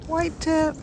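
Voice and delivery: high, sing-out greeting to a pet; calling to a bird. Real, not acted.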